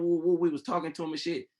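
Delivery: ordinary delivery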